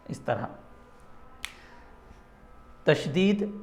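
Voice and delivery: usual speaking voice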